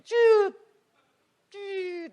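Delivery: high, squeaked laser zap; drawn high-pitched beam noise; imitated laser sound. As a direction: fake a laser pointer by voice. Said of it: "Soalnya nggak ada lasernya".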